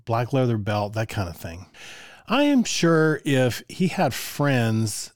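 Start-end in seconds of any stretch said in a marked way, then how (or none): 2.3-5.1 drawn out slowly, a touch higher; confident at first, then doubting; slow, sure. Real, not acted. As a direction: stretch out "I am sure" slowly as if certain. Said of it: "well, I'm actually not sure".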